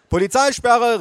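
put-on high, loud kid's command; small boy calling. A narrator's reading. Boy blocking grandmother, calling out. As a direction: shout it like a boy playing police. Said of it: "rief der kleine Jonas".

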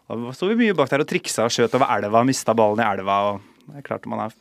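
ordinary delivery

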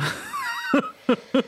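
high, sudden shriek of laughter. Real, not acted. Joking about keeping the relationship, shrieking laugh. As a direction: let a high laugh burst out.

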